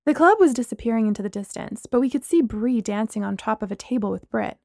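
ordinary delivery

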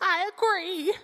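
high voice from the crowd; agreeing fan. Acted. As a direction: chime in as another fan, pitched high. Player joins as crowd fan.

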